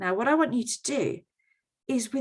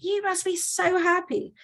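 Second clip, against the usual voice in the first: much higher, hammed-up delight; squeal-pitched mock congratulations from the neighbours; gushing congratulation; 11 semitones higher than usual. Everyone voiced congratulating the farmer.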